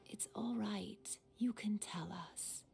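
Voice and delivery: soft, slow motherly whisper. Acted. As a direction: gently coax a worried son. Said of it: "His mom's voice is so sweet".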